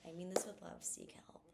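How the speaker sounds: "Seek help." whispered, soft and sincere; loving whisper. Shown as a demonstration. To a friend who said hateful things, whispered "Seek help.". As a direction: hush the "Seek help." like a kind warning. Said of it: "That's how you say it".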